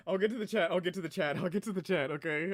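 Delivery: panting between words after laughing; out of breath. Real, not acted. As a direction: still winded from laughing. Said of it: "I'm out of breath right now".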